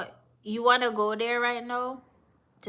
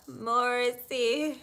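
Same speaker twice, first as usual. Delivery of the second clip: ordinary talk, nothing marked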